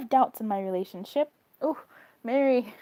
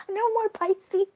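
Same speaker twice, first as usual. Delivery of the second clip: mock protest; 10 semitones higher, 4 dB louder, slower than usual. Joking protest at Pisces news.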